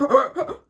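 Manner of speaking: loud re-enacted gasp; exaggerated gasp of shock; retold shocked gasp. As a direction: gasp as if stunned reading it. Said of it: "I was like".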